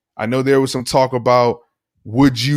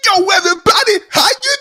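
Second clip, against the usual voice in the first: Philly five hundred Brother impression. Host imitating the brother greeting and teasing a player. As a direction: squeal it like the YouTuber's brother. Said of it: "you actually sound like the brother".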